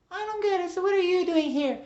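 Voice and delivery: high, loud caricature of a nosy wife; Missus Wiggles, pushy. Acted. Missus Wiggles asks after the little angel, pushy squawk. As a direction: squawk it out, prying and excited.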